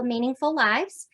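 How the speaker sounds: ordinary talk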